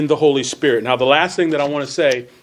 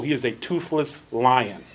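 ordinary delivery

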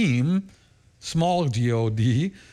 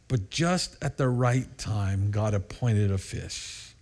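slow emphasis; 4 semitones lower, 4 dB quieter, slower than usual. Acted. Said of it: "Think about that statement".

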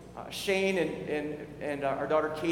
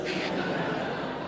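wry laugh; 3 dB quieter than usual. Retold or imitated. Laugh after pointed reply.

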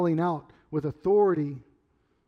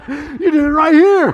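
loud, high laughing outburst; laughing accusation; 11 semitones higher, 13 dB louder than usual. Real, not acted. Pastor teasing someone present about rigged ladders, laughing shout. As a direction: call it out loudly through laughter.

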